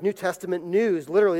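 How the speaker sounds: ordinary talk, nothing marked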